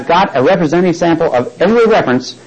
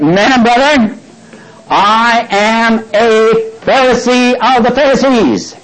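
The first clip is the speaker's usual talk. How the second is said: raised pitch, drawn-out public declaration; orator's proclamation; 6 semitones higher, slower than usual. Acted. Paul addressing the divided council, acted proclamation. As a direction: proclaim it slowly to a whole assembly.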